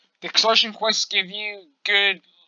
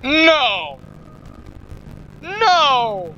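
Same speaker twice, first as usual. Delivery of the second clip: loud, high, anguished No; frustrated shout; 5 semitones higher, 7 dB louder than usual. Real, not acted. Gamer yelling at the screen over a lost quest, furious shout. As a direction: yell it out at the game in disbelief. Quest failed, must go back.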